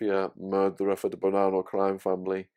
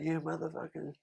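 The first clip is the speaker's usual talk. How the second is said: a mock rant, pitched higher; raised-pitch impression of a scornful old lag; imitating Two Tonys; 8 semitones higher than usual. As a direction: do the old mafia cellmate scoffing at whiners. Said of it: "he'd be like".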